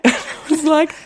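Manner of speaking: ordinary talk, nothing marked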